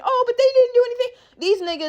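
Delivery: high, whiny mock of the complainers; mocking quote. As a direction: sneer it in a high silly voice. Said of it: "I'm not the fucking".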